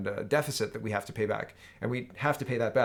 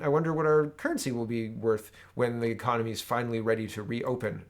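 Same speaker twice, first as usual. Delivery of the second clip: ordinary talk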